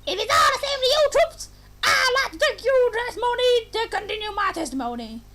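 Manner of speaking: put-on high, eager little girl soldier; tiny girl voice. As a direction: chirp it like a tiny cadet. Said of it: "make her higher".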